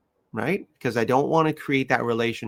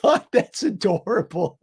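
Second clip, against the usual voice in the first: laughing delight; 12 semitones higher, 6 dB louder than usual. Retold or imitated. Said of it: "I was laughing the whole time".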